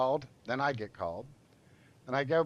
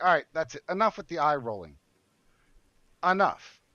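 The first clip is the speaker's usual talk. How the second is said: raised, louder voice snapping back; angry retort; 4 semitones higher, 6 dB louder than usual. Retold or imitated. Confronting the eye-rolling receptionist, loud and angry. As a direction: lay down the law, fed up.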